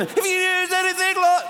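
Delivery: very high, squeezed mock singing; hammed-up key change at the top of the range. Shown as a demonstration. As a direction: push the song up into a silly high key. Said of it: "you're just changing key all the time".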